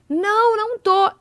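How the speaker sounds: high, loud, stretched-out protest; indignant denial. Retold or imitated. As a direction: protest like an insistent drunk friend. Retelling drunk friend's loud denial. (negação aguda e arrastada, imitando)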